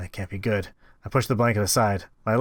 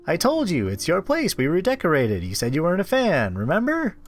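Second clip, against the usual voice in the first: higher, sugary captor talking down; Anna voice; 6 semitones higher than usual. Acted. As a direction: sweetly reassure like a creepy caretaker.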